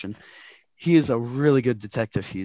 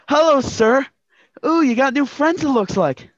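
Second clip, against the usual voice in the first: put-on a much higher, louder young boy's voice; boy detective; 11 semitones higher, 6 dB louder than usual. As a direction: play the eager kid detective greeting his boss. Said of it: "Angus McDonald, boy detective".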